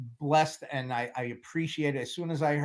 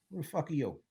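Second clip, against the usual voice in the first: Marlon Brando Godfather; 8 dB quieter, much faster than usual. Retold or imitated. Boss greets the helper next morning, softly menacing Godfather bit. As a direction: softly feign not knowing him, with menace. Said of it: "Marlon Brando Godfather bit".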